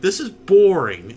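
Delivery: loud mock groan of boredom; bored complaint. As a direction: whine it, unimpressed. Gamers react to boring game.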